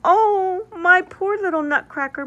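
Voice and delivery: high, loud, pained cry; pitying cry. Acted. Marie grieves over her broken Nutcracker, high pained cry. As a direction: wail over the broken toy. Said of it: "Marie cried".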